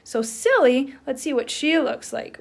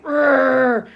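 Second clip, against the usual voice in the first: angry growl; 3 semitones higher, 9 dB louder than usual. Shown as a demonstration. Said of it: "It's like".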